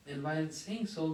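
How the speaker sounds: usual speaking voice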